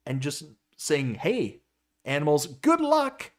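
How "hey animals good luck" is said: high, bright mock send-off; breezy fake cheerfulness; mock cheerful farewell. Mocking trucker sending animals off.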